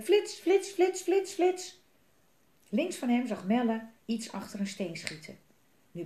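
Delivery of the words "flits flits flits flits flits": high, sharp exclamations; rapid-fire high, loud bursts; sudden flashes. A narrator's reading. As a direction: pop each word out high and loud. Sudden flashes in exciting story.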